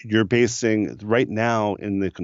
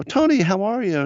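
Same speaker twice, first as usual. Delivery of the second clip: high, glad hello; delighted to hear his voice; happy greeting. Retold or imitated. Answering Tony's call, high and happy. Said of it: "I go".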